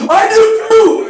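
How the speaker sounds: high, loud, slow gush of joy; overexcited fan. Retold or imitated. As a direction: burst out like a starstruck fan. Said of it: "And I go".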